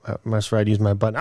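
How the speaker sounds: normal talking voice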